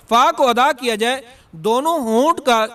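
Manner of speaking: ordinary delivery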